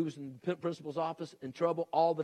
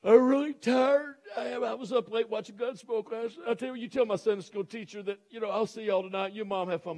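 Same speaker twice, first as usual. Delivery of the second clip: hammed-up tired complaint, pitched up; whining tired kid; 6 semitones higher, 4 dB louder than usual. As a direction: imitate a sleepy boy making excuses to his dad. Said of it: "imagine one this morning go".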